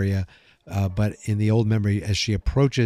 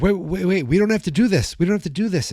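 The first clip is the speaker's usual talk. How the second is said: much higher, hurried imitation of a panicked plea; mock panic; 9 semitones higher, faster than usual. Lucas pleading with Jason holding the gun, much higher. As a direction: act out a frightened teen talking down a gun. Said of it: "Lucas is like".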